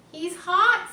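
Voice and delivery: put-on a kid chiming in about the frog; eager girl. Fern chiming in to her aunt, high and eager. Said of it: "said Fern".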